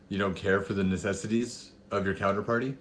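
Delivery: usual speaking voice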